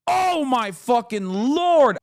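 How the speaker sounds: shocked exclamation. Real, not acted. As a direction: let the shock stretch every word out. Reacting to F-35 news.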